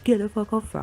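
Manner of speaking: high-pitched imitation of a protesting friend; friend intervening. Friend running over to defend her, high mock protest. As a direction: yelp it high, as the friend wading in. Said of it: "flailing her arms in her face, like, say".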